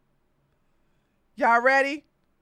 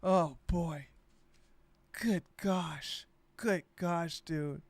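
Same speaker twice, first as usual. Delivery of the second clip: low dismayed mutter; 7 semitones lower, 8 dB quieter, slower than usual. Retold or imitated. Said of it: "I was like".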